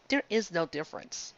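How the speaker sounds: normal talking voice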